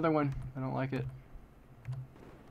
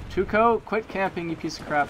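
angry yell; 7 semitones higher, 4 dB louder than usual. Real, not acted. Yelling at camping opponent.

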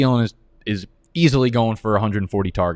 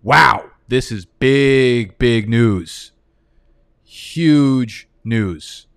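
slow, stunned big news; taken aback by breaking news; surprised; 4 dB louder, much slower than usual. Real, not acted.

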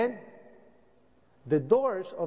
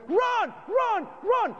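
much higher yell of run run run; urgent shouted warning; much slower than usual. Retold or imitated. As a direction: shout at everyone to run as the tower falls.

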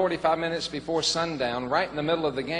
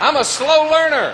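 high, loud, laughing self-mockery; laughing joke; 11 semitones higher, 9 dB louder than usual. Real, not acted. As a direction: tease yourself out loud. Self-mocking joke, congregation laughing.

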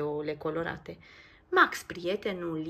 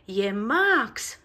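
louder, surprised cry of a name; exclaiming; 3 semitones higher, 9 dB louder than usual. Acted. Nico spots Max flying off, exclaiming loudly. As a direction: call out the discovery. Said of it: "exclamă Nico".

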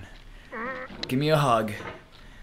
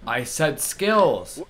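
slow insistent; 3 semitones higher, 4 dB louder, much slower than usual. Real, not acted. Repeating voice command to game.

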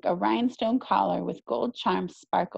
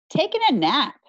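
loud high cheeky shout; shouting back; 6 semitones higher, 6 dB louder than usual. Acted. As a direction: shout it up sarcastically. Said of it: "Gabby shouted back".

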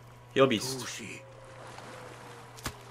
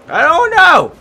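high, loud frustrated yell; exasperated shout; 13 dB louder, slower than usual. Real, not acted. Player stuck on the beast hunt, exasperated high yell. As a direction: throw up your hands and yell.

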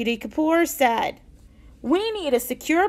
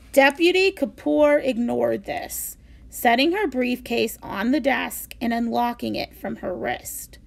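ordinary delivery, nothing marked

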